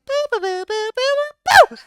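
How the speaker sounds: very high chanted boo boo; Majin Buu. Retold or imitated. Playing around the house, very high Buu chant. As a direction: squeak out his own name like a toddler monster. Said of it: "go around the house and go like".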